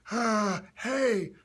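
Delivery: put-on high, airy old-timer call; old person. Shown as a demonstration. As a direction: tight throat, pushed air. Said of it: "talk together with your".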